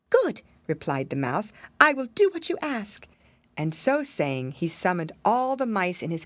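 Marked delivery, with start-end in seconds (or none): 0.1-0.4 very high, squeaky little mouse. Acted. Mouse agrees. 1.8-3.1 very high, squeaky little mouse. Acted. Mouse agrees.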